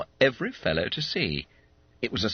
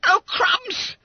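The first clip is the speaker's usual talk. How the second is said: very high, loud squeal of astonishment; startled; 6 dB louder than usual. Acted. Fat schoolboy unfolding his letter, startled. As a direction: yelp in disbelief. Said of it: "gave a startled gasp".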